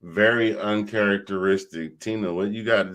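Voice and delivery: ordinary talk, nothing marked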